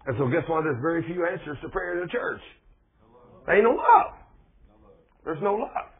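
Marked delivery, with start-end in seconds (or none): 3.5-5.9 higher and louder, ringing; loud punch line. Real, not acted. Preacher declaring the church's lack, loud. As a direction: call it out to the whole church.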